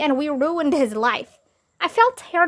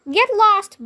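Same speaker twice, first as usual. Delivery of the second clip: much higher, louder get lost; higher mock scolding; dismissive boss; 9 semitones higher, 5 dB louder than usual. A narrator's reading. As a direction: throw him out.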